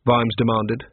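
usual speaking voice

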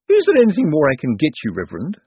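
high, loud start falling away; fussing anxiously over a guest; anxious lady. A narrator's reading. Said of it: "said the woman anxiously".

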